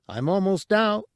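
high little alien voice; small creature voice. Acted. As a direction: pitch it up for a tiny creature. Cabe's lines.

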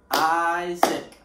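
Isaac said loud, split into two beats; syllables clapped out. Shown as a demonstration. Teacher showing kids how to clap syllables, loud and beat by beat. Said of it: "I'm gonna clap the syllables".

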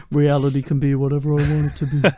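low, put-on villain voice; Thanos. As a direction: intone it like a lofty supervillain. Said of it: "Like he's Thanos".

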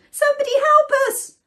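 high, loud, fast plea; frantic high-pitched storybook call; panicked cry. A narrator's reading. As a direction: shriek for rescue. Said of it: "they cried".

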